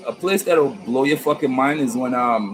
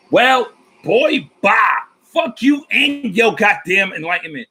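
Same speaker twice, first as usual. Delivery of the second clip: mock angry woman; 7 semitones higher, slower than usual. Angry woman dismissing his enlightenment, high mocking shout. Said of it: "She cursed me out for about".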